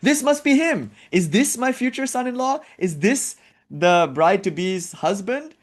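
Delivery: high, eager mother voice; hopeful mother. Retold or imitated. Bride's mother guessing at the groom, high and eager. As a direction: wonder hopefully in a high pitch. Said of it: "And Parvati's mother thinks".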